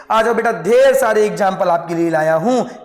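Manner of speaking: ordinary talk, nothing marked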